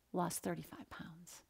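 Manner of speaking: hushed, breathless "lost thirty-five pounds"; dramatic whisper. Acted. Speaker to audience, revealing the result in a whisper.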